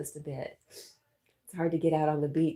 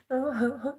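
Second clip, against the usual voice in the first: touched surprise; 10 semitones higher, 3 dB louder than usual. Real, not acted. Touched by a viewer's gift.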